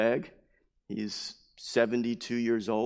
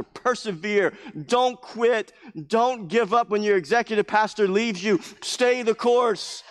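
a hint of a rousing conference preacher, higher; exhorting pastors; 9 semitones higher, 5 dB louder, slower than usual. Conference speakers encouraging weary pastors, lightly rousing. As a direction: urge them on, don't quit. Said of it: "telling the pastor".

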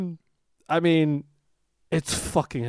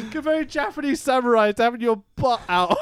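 ordinary talk

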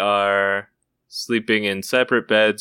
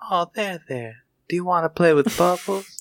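reassuring voice; 8 semitones higher than usual. Acted. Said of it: "it sounds like a reassuring voice".

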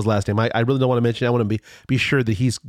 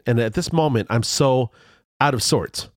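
usual speaking voice

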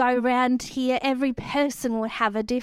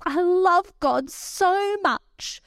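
put-on high, very slow child voice; gushing little girl; 9 semitones higher, 3 dB louder, much slower than usual. Mother voicing her daughter thanking God, high slow child voice. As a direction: stretch it out like a child gazing up at heaven. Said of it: "she looks up to heaven and she goes".

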